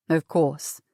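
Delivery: low-pitched doctor's assent; obliging doctor. Acted. Doctor figure answers politely.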